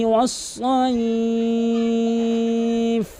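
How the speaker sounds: slow, drawn-out chanted recitation; Quran recitation. Shown as a demonstration. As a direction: recite with long stretched vowels. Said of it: "এক আলিফ টেনে ওয়াকফ করবেন". (ধীর টেনে টেনে কুরআন তিলাওয়াত)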